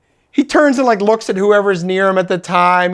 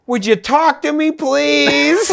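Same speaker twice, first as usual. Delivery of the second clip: desperate plea; 8 semitones higher, slower than usual. Retold or imitated. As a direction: plead desperately, pitched way up, playing it for laughs. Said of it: "just so desperate to get it to work".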